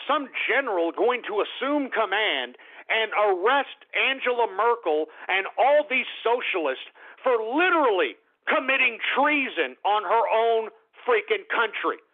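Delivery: ordinary delivery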